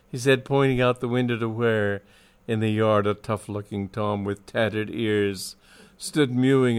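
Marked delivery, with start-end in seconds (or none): none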